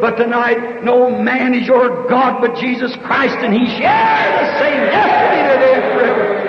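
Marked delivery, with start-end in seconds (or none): 3.4-6.4 high ringing proclamation; preaching shout. Acted. Preacher proclaiming Christ the same forever to the crowd. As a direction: proclaim it like a promise to all.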